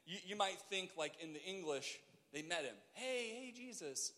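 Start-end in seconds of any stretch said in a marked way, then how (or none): 2.9-4.1 higher-pitched, light hail to someone passing; mock casual greeting. Lepers imagined casually greeting Jesus, mock casual hail. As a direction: call out a casual hello, pitched up.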